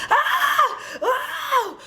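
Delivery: very high-pitched movie scream; high, piercing acted shrieks; mock screaming. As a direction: imitate a horror victim's screams. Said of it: "to screaming".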